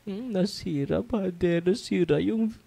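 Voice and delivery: high-pitched, whiny imitation; mock complainer. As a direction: mimic a parishioner complaining to the priest. Mimics complainer with broken phone.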